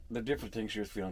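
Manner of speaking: ordinary talk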